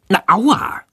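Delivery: contained cry of pain; mild yelp. Acted. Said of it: "schreit Jendrik laut".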